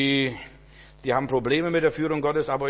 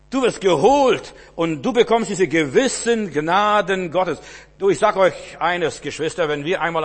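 higher, louder, full-force sermon voice; fervent preaching; 6 semitones higher, 8 dB louder than usual. Acted. Preacher telling David's story, loud fervent preaching. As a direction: preach it out with fire.

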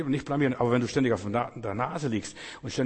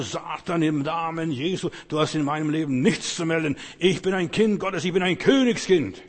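rising to a loud, higher commanding shout; rebuking Satan; 5 semitones higher, 6 dB louder than usual. Shown as a demonstration. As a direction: rebuke the devil with growing authority, ending in a shout. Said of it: "Und dann sagst du".

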